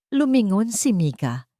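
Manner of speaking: ordinary delivery, nothing marked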